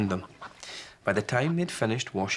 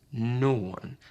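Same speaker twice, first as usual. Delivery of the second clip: usual speaking voice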